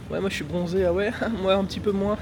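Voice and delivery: giggly, high mock boasting; mock tan bragging. Mocks people bragging about their tan.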